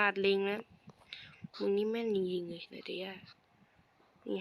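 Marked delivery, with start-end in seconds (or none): 1.6-3.2 hushed whisper under the breath; soft muttered whisper to himself. Real, not acted. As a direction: whisper it so the mic barely hears. Muttering to himself mid-game.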